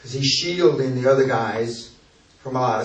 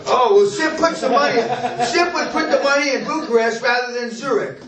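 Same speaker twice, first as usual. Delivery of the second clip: teasing; 10 semitones higher than usual. Real, not acted. Teasing trainee about money.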